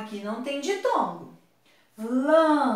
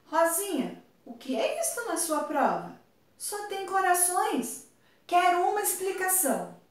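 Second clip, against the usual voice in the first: higher-pitched demand for an explanation, lightly acted; questioning teacher; 7 semitones higher than usual. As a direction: play a teacher calling a pupil to account. Comic adult confronts Rosinha over test. (professora cobrando explicação, tom mais agudo)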